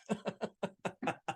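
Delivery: soft breathy evil laugh; devilish laugh. Acted. Devil laughs at the trapped politician, soft evil laugh. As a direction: cackle quietly at the trap.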